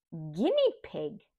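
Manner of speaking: ordinary talk, nothing marked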